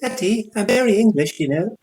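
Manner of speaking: high, prissy English voice; mock English accent. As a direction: imitate a posh English daughter. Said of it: "Just like".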